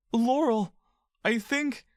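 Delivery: put-on a scared child calling his friend; frightened boy. Boy voice, dazed.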